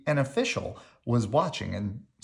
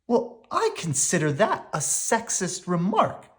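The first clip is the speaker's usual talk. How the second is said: higher, indignant little sister; mild angry snap; 8 semitones higher than usual. A narrator's reading. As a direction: Sister offended at Brother's remark. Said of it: "snapped Sister angrily".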